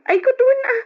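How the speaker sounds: shrill startled cry; surprised exclamation. Acted. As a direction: yelp it out in shock.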